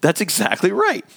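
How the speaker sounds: high, eager "right, exactly right"; eager agreement. Acted. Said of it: "here's what he's probably gonna say".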